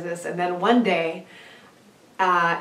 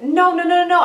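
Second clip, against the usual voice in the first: frantic protest; 9 semitones higher, 7 dB louder than usual. Retold or imitated. Pleading after account shut down.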